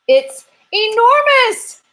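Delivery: very high, loud, stretched snap; a grumpy squirrel losing patience; snapping squirrel. Acted. Squirrel getting grumpy with the detectives, snapping high and loud. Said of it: "snapped the squirrel".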